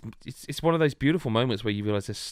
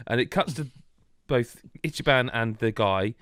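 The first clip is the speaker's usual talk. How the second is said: ordinary talk, nothing marked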